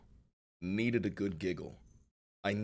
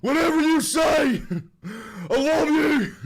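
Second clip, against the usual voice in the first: high, loud adoring shriek; mock fan scream; 9 dB louder than usual. Streamer mocking a fan with a loud shriek.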